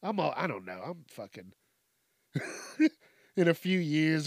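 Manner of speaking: ordinary delivery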